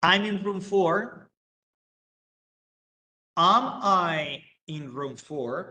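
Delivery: slow, clear English for learners; careful classroom English, word by word; slow model sentence. Shown as a demonstration. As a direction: spell it out slowly for the class. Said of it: "teacher models statement and question".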